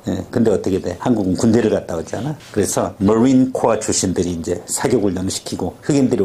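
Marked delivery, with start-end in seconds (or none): none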